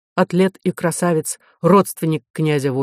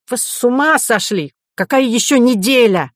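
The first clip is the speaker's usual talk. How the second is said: outraged; 7 semitones higher, 4 dB louder than usual. A narrator's reading. Professor outraged at the week's delay.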